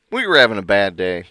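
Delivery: usual speaking voice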